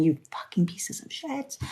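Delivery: a hushed, swearing aside under the breath; whispered curse at the endless tests. Acted. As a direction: whisper it like a private grumble at the universe. Muttered aside cursing the tests.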